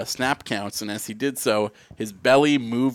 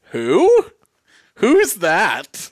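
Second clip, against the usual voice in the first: high, loud mock bafflement; mock baffled; 8 semitones higher, 8 dB louder, much slower than usual. Retelling a friend's clueless reply, loud mock surprise. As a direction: imitate a friend who's never heard of her. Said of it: "And he said".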